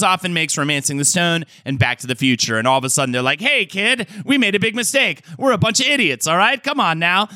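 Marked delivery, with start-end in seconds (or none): 3.4-7.3 put-on high, pleading old-Hollywood studio exec; Transatlantic accent. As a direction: wheedle like a 1940s fast-talker. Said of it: "Transatlantic accent".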